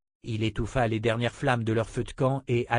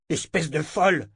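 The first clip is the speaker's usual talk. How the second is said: louder, higher furious cry; angry shout; 5 semitones higher, 4 dB louder than usual. Acted. Richard yells at Verna after the killing, furious. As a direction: yell at her in outrage. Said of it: "cria-t-il".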